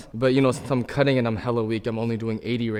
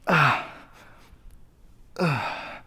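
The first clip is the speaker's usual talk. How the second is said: effort grunts; 5 semitones higher than usual. Real, not acted. Grunting through the reps.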